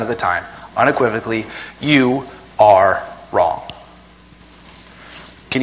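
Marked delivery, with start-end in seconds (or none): 1.8-3.7 very slow, every word weighted; slow emphatic. Real, not acted. Preacher tells church they are wrong, slowly. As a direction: lay it down like a verdict. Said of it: "punchline slowed".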